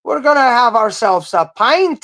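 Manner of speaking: high, loud, hammed-up pub toast; mock rowdy drinking-buddy voice; cheerful toast voice. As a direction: raise a mug and bellow it out high and slow. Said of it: "she's saying".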